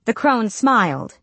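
usual speaking voice

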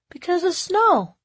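put-on high-pitched, emphatic little boy; young son. A narrator's reading. Boy explaining his choice to mom, childlike.

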